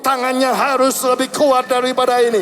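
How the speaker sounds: high, sustained, ringing preacher's exhortation; rally the congregation with slow, stretched commands; full-force preaching. Real, not acted. Berkhotbah penuh semangat kepada jemaat. (seruan khotbah berapi-api)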